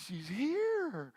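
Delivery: breathless, smitten excitement; thrilled. Retold or imitated. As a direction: say it like she just walked in. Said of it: "It's like".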